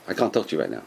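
low, soft refusal; calm refusal. Retold or imitated. Rabbi declining to talk to an angry person, calm refusal. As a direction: turn them away quietly, pitched well down.

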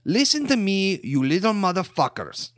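loud, high, ranting fake Ukrainian accent. Ukrainian Ray ranting at mask-wearing hackers in a loud Ukrainian accent. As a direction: rant like an angry Ukrainian tough guy. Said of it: "in my Ukrainian accent".

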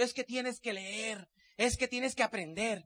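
slow, soft imitation of the preaching mentors; mocking mentor. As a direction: drag it out softly, like a lecturing mentor. Imita a los diamantes. (imitación lenta y suave de un mentor sermoneando)

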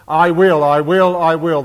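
each "I will" hit hard and loud; stressed I will. Shown as a demonstration. Preacher drilling "I will" into the congregation, loud and punched. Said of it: "I'll just emphasize".

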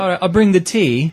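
hammed-up Cockney for the tea. Dad doing a Cockney for his daughter, mock Cockney accent. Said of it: "you go straight Cockney".

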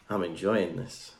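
low, slow, quiet aside; hushed, unhurried mock stage aside; demonstrated aside. Shown as a demonstration. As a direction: step back and savour it softly. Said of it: "you just step back and you go".

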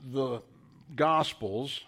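ordinary talk, nothing marked

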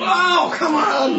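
frustrated outcry. Real, not acted. Wrong answer given.

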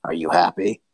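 very low monster voice; sinister creature. Acted. Unmasked monster looks over at its attacker, sinister. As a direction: drop deep, taunting your attacker. Transformed creature addresses the party.

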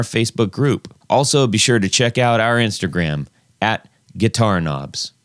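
loud upbeat podcast outro; outro announcer. Acted. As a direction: read the promo like an announcer.

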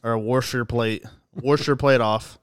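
"wash" drawn out as warsh, slow; family's R-coloured pronunciation. Retold or imitated. Co-host quoting the family about dishes, slow "warsh". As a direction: slowly put an R into "wash". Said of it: "that's what they say is warsher".

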